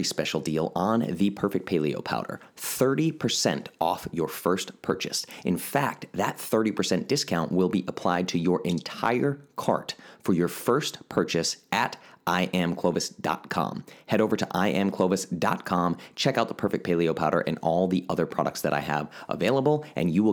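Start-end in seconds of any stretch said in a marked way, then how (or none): none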